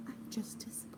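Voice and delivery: whispered like a let-down parent; joking whisper. Pretend. To her knitting, a playful whispered disappointment. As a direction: breathe it out as a mock letdown. Mock-stern joke about yarn colour.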